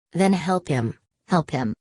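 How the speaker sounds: usual speaking voice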